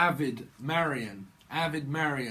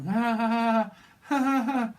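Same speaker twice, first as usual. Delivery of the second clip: mock spelled-out ha ha ha; fake laugh; 9 semitones higher, 6 dB louder than usual. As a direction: laugh on purpose, higher and louder. Fake laugh in acted quote.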